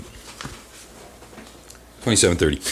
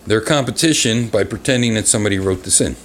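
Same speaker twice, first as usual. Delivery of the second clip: normal talking voice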